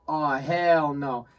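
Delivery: drawn-out mock refusal; slow, emphatic balking; retold dismay. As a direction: stretch it out in disbelief. Retold reaction to seeing dark trail.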